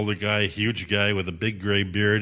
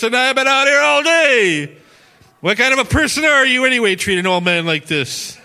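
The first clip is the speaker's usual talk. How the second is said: screaming in someone's face; 10 semitones higher, 9 dB louder than usual. Retold or imitated. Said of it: "he started screaming in my face".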